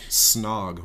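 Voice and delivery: usual speaking voice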